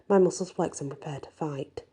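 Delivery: plain reading voice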